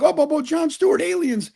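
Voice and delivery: high, whiny mock of doubters; sneering parody of people who doubt; mocking skeptics. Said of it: "people are like".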